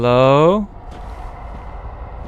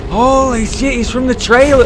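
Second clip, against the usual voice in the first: excited; 9 semitones higher than usual. Real, not acted. Recognising the boss.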